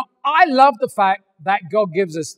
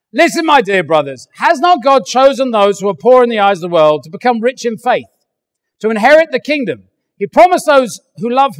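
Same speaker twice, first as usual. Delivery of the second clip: louder, earnest Bible reading; scripture reading; 5 dB louder than usual. Acted. Preacher reading James chapter two to the church, earnest. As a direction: proclaim the passage to the congregation.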